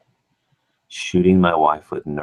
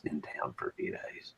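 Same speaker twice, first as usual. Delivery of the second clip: real hushed whisper; keeping quiet; 13 dB quieter than usual. Real, not acted. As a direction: whisper so the sleeping buddy isn't woken. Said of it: "I have to be quiet".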